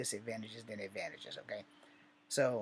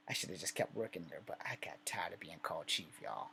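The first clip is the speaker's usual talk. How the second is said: muttered complaint; 6 semitones lower, 6 dB quieter than usual. Real, not acted. Griping about being called chief.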